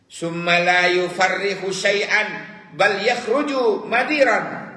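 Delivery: high, loud chanted declamation of the Arabic saying; impassioned preaching. Acted. Preacher declaiming the rotten egg proverb to listeners, impassioned and loud. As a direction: cry the proverb out to the congregation.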